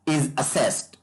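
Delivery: ordinary talk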